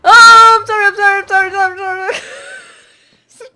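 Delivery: high, loud frantic apology ending in a wail; screaming sorry. Real, not acted. Pigmen hit her, screaming apology ending in a cry. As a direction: scream for forgiveness.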